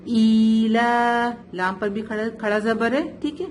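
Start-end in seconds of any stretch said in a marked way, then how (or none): 0.0-1.3 ایلا with long drawn ا, very slow; stretched vowel. Shown as a demonstration. Teacher shows student the long alif, drawn out. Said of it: "ایک الف کے بعد پر کھینچ کے پڑھیں گے".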